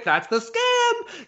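high, slow, laughing through the words; laughing realisation. Retold or imitated. Teller realising the monk's scam, laughing high and slow. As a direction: crack up as the scam dawns. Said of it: "And I was like".

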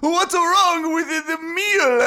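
high, loud, slow hammed-up Italian accent; bad Italian accent. Panicked improviser drags him onstage, bad Italian accent. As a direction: overdo a cartoon Italian accent. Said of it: "a really bad Italian accent".

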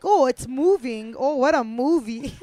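loud, high mock amazement; hammed-up awe at a silly word; mock wonder. Mocks the word movie in quote.

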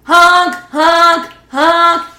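high, loud imitated honks; truck horn honks. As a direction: honk like an approaching truck.

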